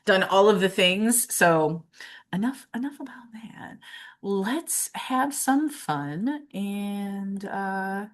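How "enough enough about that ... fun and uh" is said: soft whisper shaking it off; whisper, then slow down. Real, not acted. Shaking off nerves.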